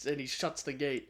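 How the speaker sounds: ordinary talk, nothing marked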